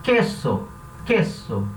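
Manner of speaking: queso dito com K e E fechado, "ke-so"; Spanish word. Shown as a demonstration. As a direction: pronuncie queso devagar como modelo. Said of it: "Por exemplo". (pronúncia de queso em espanhol)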